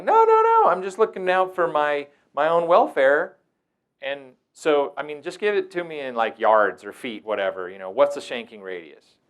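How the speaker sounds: high, loud mock-earnest protest; sarcastic. Staff member answering the kid, loud mock-earnest sarcasm. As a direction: play innocent while teasing. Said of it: "being silly ... in a sarcastic way".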